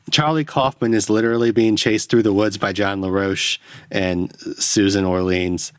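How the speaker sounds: normal talking voice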